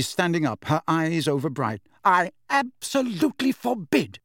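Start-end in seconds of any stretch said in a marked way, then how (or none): 2.0-4.2 high, emphatic maternal outrage; outraged forbidding. A narrator's reading. Overwrought mother forbidding the children, high and emphatic. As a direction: forbid it hotly, pitched high.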